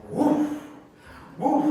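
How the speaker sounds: ordinary delivery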